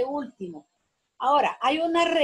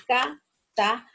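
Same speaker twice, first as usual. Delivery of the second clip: "casa" slowly divided into ca... sa; syllable by syllable; 3 dB louder than usual. Shown as a demonstration. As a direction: give "casa" as two separate syllables. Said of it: "dos sílabas átonas".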